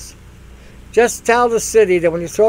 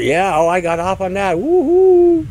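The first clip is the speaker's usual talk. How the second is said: mock ecstasy; 3 semitones higher, 4 dB louder than usual. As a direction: play the giddy millennial climaxing. Mock climax over monitor.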